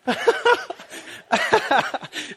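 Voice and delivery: airy wheezing laugh; high breathless laugh. Real, not acted. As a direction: let the laugh squeeze out. Pastor laughing at story.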